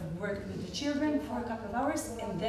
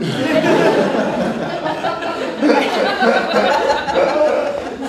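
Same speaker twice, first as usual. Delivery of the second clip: big laugh; 5 semitones higher, 14 dB louder than usual. Real, not acted. Overcome retelling the elevator.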